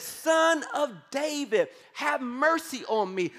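high, slow pleading call. A narrator's reading. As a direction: cry out harder, won't be silenced. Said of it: "he only shouted louder".

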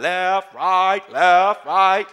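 loud barked drill count; drill instructor cadence. Retold or imitated. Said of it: "I got 'em out on the line".

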